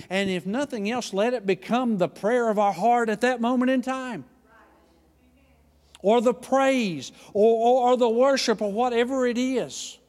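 high, loud, swelling preacher's climb; preaching at full force. Acted. Preacher rousing the church to worship, loud and high. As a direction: lift the congregation with rising fervor.

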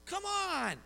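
high, impatient "come on"; irritated. Retold or imitated. Driver stuck waiting in traffic, irritated. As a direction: groan it at the slow car. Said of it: "I got irritated 'cause I'm like".

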